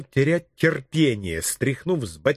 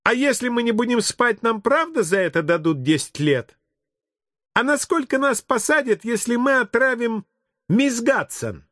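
put-on high, piping kid voice; inquisitive child; 9 semitones higher than usual. Children quizzing the policeman about prison, high kid voice. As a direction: ask high like a small child.

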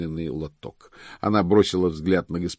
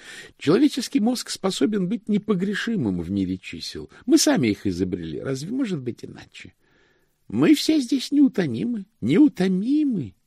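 high, fervent pedant's voice; high-pitched, proud clerk; zealous chief cashier; 13 semitones higher than usual. Acted. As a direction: extol human arithmetic in a raised voice.

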